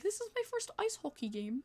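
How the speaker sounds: put-on British voice; British accent. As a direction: play the polite British newcomer, high and soft. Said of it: "so the British guy".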